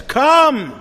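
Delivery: "Come" cried out high and loud; loud call. Acted. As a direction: fling "Come" out as a summons. Prophet's call quoted in sermon.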